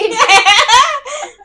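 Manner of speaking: high loud burst of laughter; loud shriek laugh. Real, not acted. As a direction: howl with laughter in shock. Shocked by fish story.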